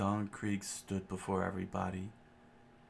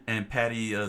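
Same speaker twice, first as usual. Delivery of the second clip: normal talking voice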